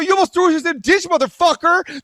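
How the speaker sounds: high, fast-starting indignant outburst; heated road-rage comeback; retold angry retort. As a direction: re-enact snapping back at a driver. Acting out road rage argument.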